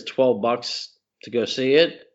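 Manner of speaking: ordinary talk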